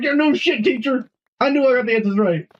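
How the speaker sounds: high-pitched mock indignation; exasperated retort. Retorting to teacher in a story.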